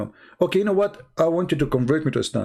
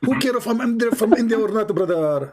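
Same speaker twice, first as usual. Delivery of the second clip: higher, insistent mock Indian accent; lightly put-on Indian accent, pitched up; 5 semitones higher, 4 dB louder than usual. Debater brushing off caller's remark on origins, mock Indian accent. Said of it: "yeah, I'm an Indian".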